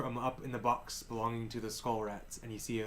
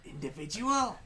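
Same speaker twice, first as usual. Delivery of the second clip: other rat head; 6 semitones higher, 4 dB louder than usual. Acted. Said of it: "One of the other heads says".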